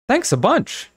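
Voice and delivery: high mock thanks; sarcastic. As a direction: drip with sarcasm. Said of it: "Sabrina said sarcastically".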